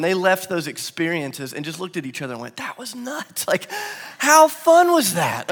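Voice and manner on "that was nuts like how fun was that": high, loud, slow burst of thrill; amazed disciples. Retold or imitated. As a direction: exclaim like stunned, thrilled friends. Disciples after a miracle.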